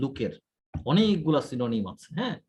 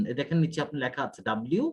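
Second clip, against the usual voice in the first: normal talking voice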